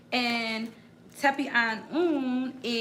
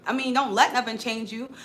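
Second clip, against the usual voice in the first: ordinary delivery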